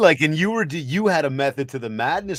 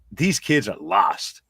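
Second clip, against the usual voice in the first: mock despair; much slower than usual. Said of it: "I was like".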